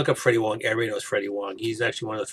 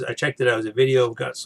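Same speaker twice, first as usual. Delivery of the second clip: usual speaking voice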